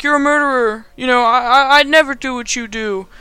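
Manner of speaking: put-on righteous hero; Spider-Man. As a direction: protest high, as a hammed-up hero. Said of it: "And he's like".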